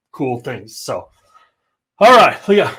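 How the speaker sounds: breathless, catching his breath. Real, not acted. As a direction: talk while short of air. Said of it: "I'm literally out of breath".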